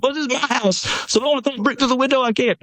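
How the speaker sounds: hammed-up self-righteous argument; mock defiant protest. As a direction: push the excuse out fast and high. Voicing a hypothetical man's defiant argument.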